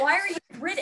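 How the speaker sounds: high, loud baffled question. Acted. Asa questioning the others' silliness, baffled. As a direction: ask it loud, in mock disbelief. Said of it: "And he's like".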